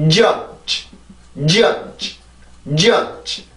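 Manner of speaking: judge with a strong first j and a soft, released final dge; soft final consonant. Shown as a demonstration. Teacher contrasting both sounds, judge ending softly. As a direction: hit the start of judge, let the end go gently on leftover air. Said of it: "아주 부드럽고 천천히 발음을 해주면".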